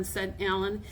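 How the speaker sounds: ordinary delivery, nothing marked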